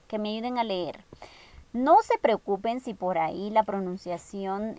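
ordinary talk

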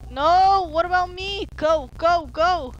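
high urgent go go; urgent pleading. Real, not acted. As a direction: rush the friend on. Left outside as zombies attack.

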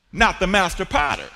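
usual speaking voice

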